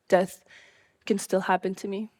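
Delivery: ordinary delivery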